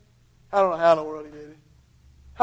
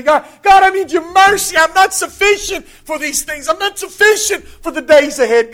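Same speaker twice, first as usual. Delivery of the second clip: pleading prayer growing louder and higher; sinner's prayer; 6 semitones higher, 9 dB louder than usual. Acted. Preacher voicing a sinner's prayer for listeners, pleading loudly. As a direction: cry out to God like a desperate sinner. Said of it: "and say".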